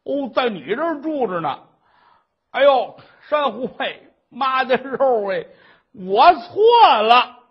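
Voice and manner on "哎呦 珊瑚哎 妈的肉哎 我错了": louder, drawn-out cry of remorse, dragging the last words; pleading apology. Acted. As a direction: beg her forgiveness at full voice. 婆婆向儿媳认错. (拖长声音大声认错)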